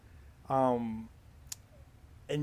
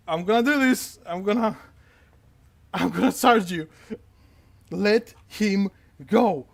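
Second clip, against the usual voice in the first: loud, raised, punched-out threat; threatening guard; 4 semitones higher, 6 dB louder than usual. Acted. Spear guard about to charge the gunman, loud and desperate. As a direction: push each phrase out forcefully, slowing on the final demand.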